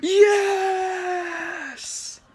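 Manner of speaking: long, high drawn-out cry of yes; high held cheer; crowd cheer. Acted. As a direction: draw out a high, elated yes. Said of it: "Everyone says".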